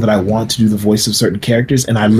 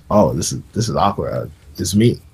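sheepish admission; 5 semitones lower, 6 dB quieter than usual. Retold or imitated. Awkward admission he voiced Xerxes.

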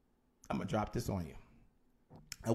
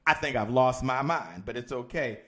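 normal talking voice

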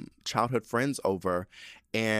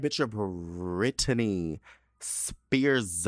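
BRITNEY drawn way out, very slowly; emphatic; much slower than usual. Real, not acted. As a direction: stretch out Britney Spears for maximum emphasis. Hyping up who she is.

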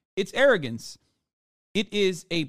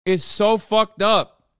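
drawn out and getting heated; slow rising outrage; 5 semitones higher, 3 dB louder, slower than usual. Real, not acted. Streamer to clip, starting to boil over. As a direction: let the disbelief climb, slowly.

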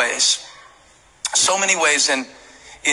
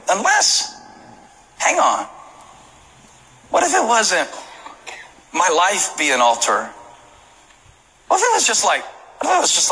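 sudden idea; 5 semitones higher than usual. Retold or imitated.

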